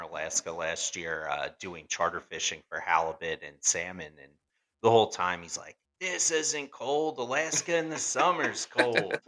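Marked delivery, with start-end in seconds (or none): 6.0-9.2 higher, drawn-out imitation of a boastful crewmate; mock bragging. Imitating a crewmate dismissing the winter cold, mock bragging. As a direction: scoff slowly in a raised, put-on voice.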